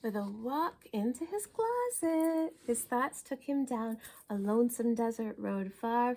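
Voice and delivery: ordinary delivery, nothing marked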